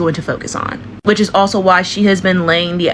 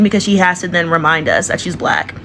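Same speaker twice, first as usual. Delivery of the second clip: ordinary talk, nothing marked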